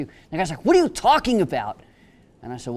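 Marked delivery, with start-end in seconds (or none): 0.6-1.8 higher, louder, incredulous; baffled outburst. Retold or imitated. Inmate baffled by his lawyer's offer of a hug, incredulous. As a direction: thrown completely, demanding an explanation.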